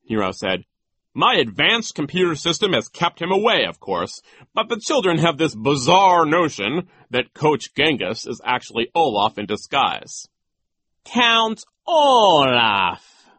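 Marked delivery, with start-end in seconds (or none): 1.1-10.3 higher, louder self-important character voice; pompous Nero. A narrator's reading. Nero boasts in the story. 11.0-13.3 drawn-out, high, loud villain voice; very slow. A narrator's reading. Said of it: "Genghis said slowly".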